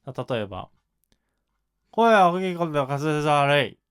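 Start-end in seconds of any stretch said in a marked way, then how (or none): 1.9-3.8 big voice with badly mumbled articulation; loud, slurred diction. Shown as a demonstration. Teacher showing students a big but hard-to-understand voice, loud and slurred. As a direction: say it loud but let the consonants smear. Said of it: "声量は大きいけど滑舌は悪いみたいな".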